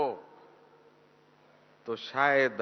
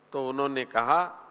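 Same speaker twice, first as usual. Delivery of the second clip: ordinary talk, nothing marked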